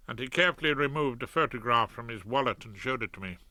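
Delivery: ordinary delivery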